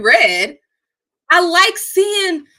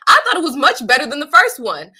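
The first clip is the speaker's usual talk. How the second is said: usual speaking voice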